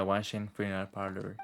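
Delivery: usual speaking voice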